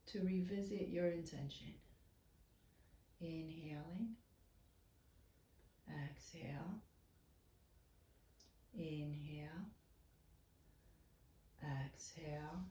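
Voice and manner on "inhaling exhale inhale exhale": soft, near-whispered breathing cues with long pauses; almost whisper each cue, low and unhurried; hushed breath cues. Real, not acted. Yoga teacher leads quiet breathing.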